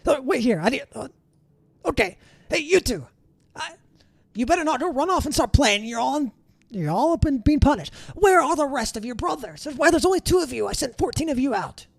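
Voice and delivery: high, exasperated little guard voice; high scolding beet guard. Acted. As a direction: scold the two helpers in a squeaky voice.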